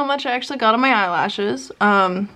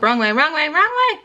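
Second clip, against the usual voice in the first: urgent warning, climbing higher; alarmed; 4 semitones higher, faster than usual. Real, not acted. Woman as the robot goes the wrong way, alarmed. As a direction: panic a little more with each repeat.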